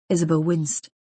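ordinary delivery, nothing marked

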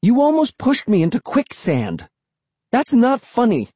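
high, indignant scolding; angry protest. A narrator's reading. Narrator nearly pushed into quicksand, angry protest. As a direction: scold with the pitch way up. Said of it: "I cried angrily".

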